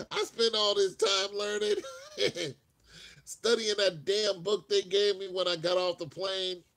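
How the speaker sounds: exasperated laugh about the wasted effort; a loud, amused complaint; laughing rant. Real, not acted.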